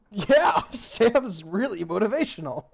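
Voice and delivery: excited, laughing loudly. Real, not acted. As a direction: laugh while praising, loud and high. GM laughs at the rolls.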